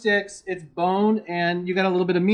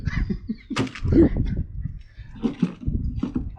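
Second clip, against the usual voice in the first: breathless giggle; real airy laugh at himself; helpless giggle at the freezer-burnt clams; real laughter; 9 semitones higher than usual. Real, not acted. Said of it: "I don't know why I'm laughing".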